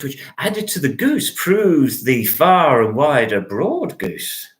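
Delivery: usual speaking voice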